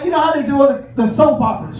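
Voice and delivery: ordinary talk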